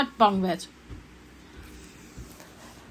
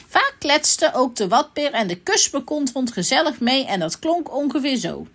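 ordinary delivery, nothing marked

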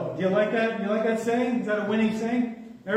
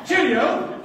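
put-on higher, louder English-accented farewell; mock English accent; 6 semitones higher, 5 dB louder than usual. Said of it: "First English king of France".

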